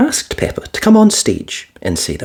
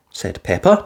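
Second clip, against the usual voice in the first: ordinary delivery, nothing marked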